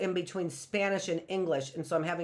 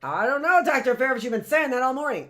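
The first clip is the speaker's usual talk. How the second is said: higher, louder whiny student voice; mock student complaint; 6 semitones higher, 7 dB louder, faster than usual. Teacher voicing a student tired of hearing it, whiny. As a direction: play the grumbling student, fast. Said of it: "You can".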